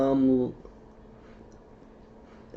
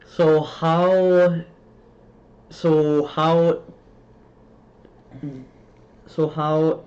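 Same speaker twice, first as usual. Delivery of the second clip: stammered start, stretched and blocked; stuttering; 4 semitones higher, 6 dB louder, much slower than usual. Real, not acted. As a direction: get stuck on the opening words, forcing them out louder. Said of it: "can't even speak right now".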